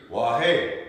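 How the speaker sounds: WAHE, loud rising push on the end. Shown as a demonstration. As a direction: lean hard into the last syllable of Wahe. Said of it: "say it louder in the second syllable".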